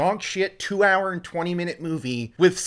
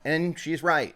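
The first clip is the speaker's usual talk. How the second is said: ordinary delivery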